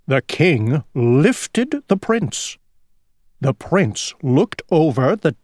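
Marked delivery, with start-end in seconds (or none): none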